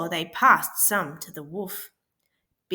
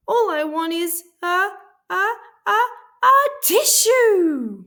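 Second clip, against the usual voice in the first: building to a sneeze; 11 semitones higher, 8 dB louder than usual. A narrator's reading. Sick wolf tries to ask for a tissue before sneezing. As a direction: stretch the a's as the sneeze builds. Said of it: "sniffled Big Bad".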